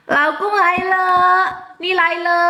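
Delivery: Laukung, Laila called high; names pitched well up; calling out names. Shown as a demonstration. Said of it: "panggilnya Laukung aja deh".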